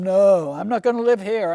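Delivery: loud, emphatic no; loud refusal. Retold or imitated. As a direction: refuse it loudly. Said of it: "And some of them".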